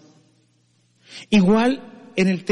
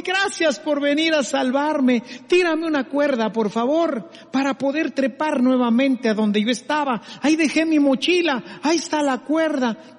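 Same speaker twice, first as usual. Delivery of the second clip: higher, pleading climber on a branch; relieved climber; 5 semitones higher than usual. Acted. Climber asks God to throw him the rope, high and relieved. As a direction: thank God and beg for the rope.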